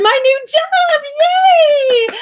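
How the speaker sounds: rising into a high, loud squeal; excited cheer. Real, not acted. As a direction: burst out cheering. Reading the news, then cheering.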